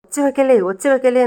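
high-pitched pleading to stop; acted panic, quick high plea; urgent shushing. A narrator's reading. Intruder begging the screaming girl to hush, urgently.

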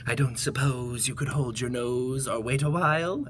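low, subdued crocodile voice; sad crocodile. Acted. Crocodile asks the elephant to wait, low and sad. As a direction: ask timidly in a low, sad croc voice. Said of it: "asked the crocodile with a sad little smile".

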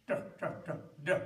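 repeated da with the tongue curled back; retroflex da. Shown as a demonstration. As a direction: curl the tongue and tap da, da, da. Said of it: "you make a dental sound like da".

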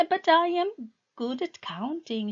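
high, quick rush of eagerness; wife eagerly offering help. Acted. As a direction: jump in eagerly to prove herself. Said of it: "she said eagerly".

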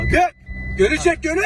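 high urgent shout; calling out. Real, not acted. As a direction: yell the instruction across. Calling out while stuck in mud.

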